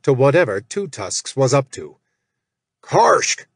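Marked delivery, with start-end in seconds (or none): 2.8-3.5 calling out to stop the gnoll; bellowed name. Acted. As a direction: bellow his name across the pasture. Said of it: "Jack bellowed".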